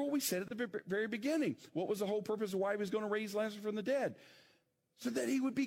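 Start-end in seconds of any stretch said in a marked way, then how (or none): none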